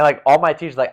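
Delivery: ordinary talk, nothing marked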